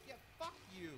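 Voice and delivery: high angry curse; raging gamer. Real, not acted. Gamer cursing mob.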